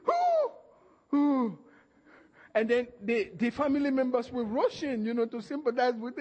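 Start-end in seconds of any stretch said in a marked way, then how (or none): 0.1-1.6 high, loud imitated moans; milk the pity with loud moaning; mock groan. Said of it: "He goes".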